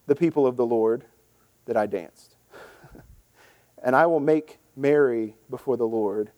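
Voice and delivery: scripture reading. Acted. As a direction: read David's reply steadily from the text. Reads scripture passage.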